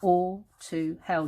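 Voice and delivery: very slow, syllable by syllable; counting syllables. Shown as a demonstration. Teacher counting the line to ten, very slow syllables. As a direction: stretch and separate every syllable of the verse. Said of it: "Yeah, they're ten".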